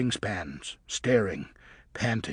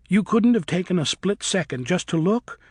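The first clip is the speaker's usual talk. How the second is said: accusing; 7 semitones higher, 5 dB louder than usual. A narrator's reading. Luna accusing Griffin.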